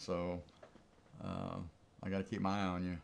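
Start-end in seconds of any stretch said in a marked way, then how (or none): none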